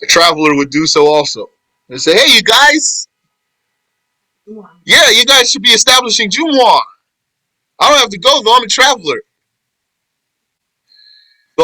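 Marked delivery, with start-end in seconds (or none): 2.1-6.8 high-pitched mock call to the group; imitated urging. Voices women urging negligent men. 7.8-9.2 high-pitched mock protest; imitated excuse. Voices a traveler's excuse.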